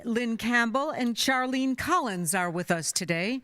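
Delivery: ordinary talk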